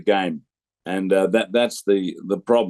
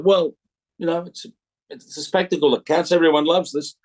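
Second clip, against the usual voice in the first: shrugging reply; 8 semitones higher than usual. Retold or imitated. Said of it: "just looked at him and said".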